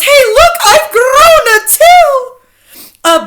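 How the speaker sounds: very high, loud yell; full high-pitched child's yell. A narrator's reading. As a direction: shriek it out as a startled girl. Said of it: "She yells".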